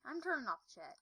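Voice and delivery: slurred, sleepy speech; groggy from anesthesia. Real, not acted. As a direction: talk like you just woke from anesthesia. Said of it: "How come I'm talking really weird?".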